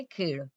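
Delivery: खेड़ ending on a retroflex flap; Marathi khel. Shown as a demonstration. Teacher repeating the Marathi word for students, modelling the sound. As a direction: repeat खेड़ with the tongue curled back. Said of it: "तो मराठी में कहेंगे".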